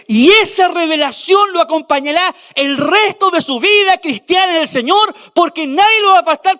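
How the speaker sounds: loud, high, driving sermon climax; preaching at full force. Real, not acted. Pastor proclaiming Christ's reign to the church, fervent preaching.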